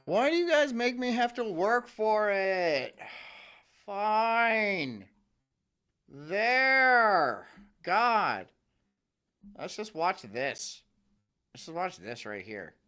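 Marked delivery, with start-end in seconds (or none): 0.0-8.5 drawn-out, higher-pitched grumbling; long-suffering mock exasperation; exasperated whine. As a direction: give in like a put-upon teenager, dragging each word. Grudgingly doing what viewers ask.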